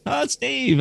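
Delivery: friendly colleagues happily recognising him; delighted greeting. Retold or imitated. Board members greeting an old colleague, delighted and sing-song.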